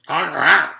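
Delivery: high, loud groan with mouth wide open. A narrator's reading. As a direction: groan through a gaping mouth. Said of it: "said Jason".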